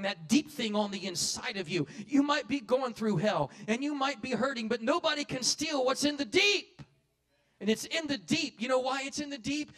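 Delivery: loud, rising sermon climax; full-throated preacher's climax; preaching at full force. Real, not acted. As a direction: build the preaching to a shout.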